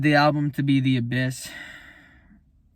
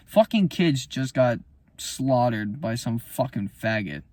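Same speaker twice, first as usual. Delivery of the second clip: normal talking voice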